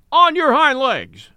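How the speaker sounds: high, loud, drawn-out challenge; squealed. A narrator's reading. Mule challenging the horse to a fight, squealed. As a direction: squeal it out slowly as a battle cry. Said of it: "squealed Billy".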